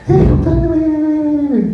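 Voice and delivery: high-pitched imitation of chattering colleagues; mock chiming in. Nurses piling on at the doctor, mock high-pitched nagging. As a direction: pipe up high, mimicking the other nurses.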